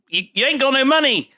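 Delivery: a hint of a put-on character voice; Andy Garcia. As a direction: pitch it up for the quoted jibe. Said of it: "Andy Garcia, is, is saying".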